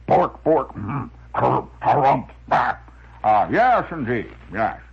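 sputtering, harrumphing windbag; windbag character. Acted. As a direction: bluster through each word like a comic-strip blowhard. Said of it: "Who used that kind of phraseology?".

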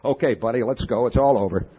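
hurried mock detective collaring a suspect; quick stern cop. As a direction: rattle it off fast like a plainclothes cop. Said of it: "very quickly".

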